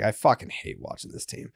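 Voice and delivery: whispered, fed-up mutter; muttered frustration. Retold or imitated. Watching the Sox, a whispered fed-up mutter. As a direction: hiss it under your breath. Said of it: "I was just watching the game being like".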